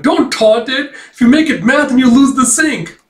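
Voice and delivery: high, loud mock-frantic shout; alarmed warning. Okunogi warning the fighter about the sync, high frantic yell. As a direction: imitate a panicked operator yelling a warning.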